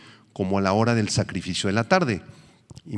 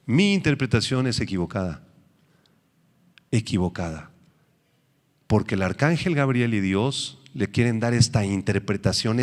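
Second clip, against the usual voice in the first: normal talking voice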